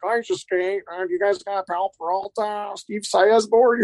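high, slow mock dad voice; mocking a dad who quit skating; clueless dad customer. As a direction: ham up a clueless older customer. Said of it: "I get all these dads my age".

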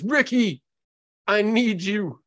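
high, pleading wounded man calling Ricky; pleading Kyle. Acted. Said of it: "So he says".

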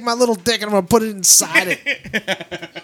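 high-pitched mock excitement; Wayne. Teasing a friend over Ghostbuster donuts, high mocking impression. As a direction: imitate an overexcited donut fan. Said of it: "Wayne's like".

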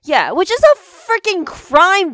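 loud, high, drawn-out indignant rant; hammed-up protest, punching it out; mock outrage. Mock rant about missing show.

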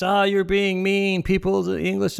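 mock a protesting audience member; a hint of a whiny heckler; whiny objector. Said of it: "somebody says".

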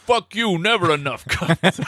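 high mock-indignant mover; playfully defiant, breaking into a laugh. Mover snaps back in the bit.

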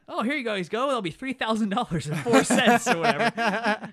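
high, loud ticket-seller patter; mock busy clerk. Ticket guy hands over tickets with the price, mock loud patter.

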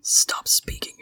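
stern breathless whisper; telling the teaser to hush, whispered; hissed whisper. Acted. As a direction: shut him up without a sound. Lan Wangji silencing a tease.

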